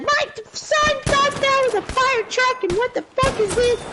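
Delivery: very high mock-outraged mother; mom flipping out. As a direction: rant like a mom losing it. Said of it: "she just starts flipping out".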